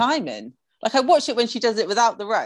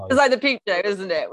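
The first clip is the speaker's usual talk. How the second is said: usual speaking voice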